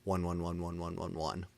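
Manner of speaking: usual speaking voice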